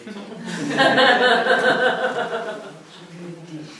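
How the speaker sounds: a long loud laugh at his own joke. Real, not acted. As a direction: burst out laughing and let it roll.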